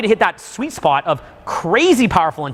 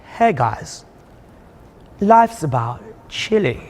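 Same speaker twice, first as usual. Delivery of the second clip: lower, slow, laid-back drawl; too chill; 3 semitones lower, much slower than usual. Retold or imitated. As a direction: overdo being relaxed. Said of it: "if I even try to be too chill".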